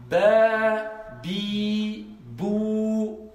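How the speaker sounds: "Ba, bi, bou" each vowel held long and level; teacher model, drawing out every vowel; slow model reading for the class; long vowels demo. Shown as a demonstration. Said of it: "vous lisez ici".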